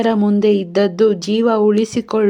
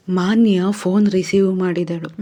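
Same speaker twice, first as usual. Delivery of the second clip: usual speaking voice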